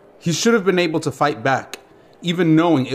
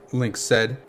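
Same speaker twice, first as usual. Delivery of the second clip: ordinary delivery, nothing marked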